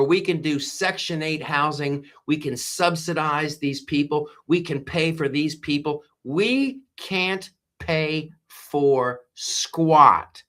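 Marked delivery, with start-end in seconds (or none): 6.2-10.4 very slow and drawn out; fed-up rant about spending. Real, not acted. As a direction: stretch each word in disgust. Ranting about government waste.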